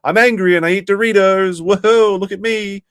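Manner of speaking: high, loud parody of an angry YouTuber; hammed-up, hollering gamer mockery. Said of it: "this stuff didn't age very well".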